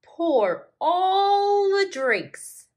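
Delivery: very high, slow, gurgly voice; gurgling lynx. Acted. Lynx pouring drinks, high gurgling voice. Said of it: "gurgled the lynx".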